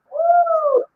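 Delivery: high, loud whoop; excited whoop. Real, not acted. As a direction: let out a big hype woo. Hyping the radio show.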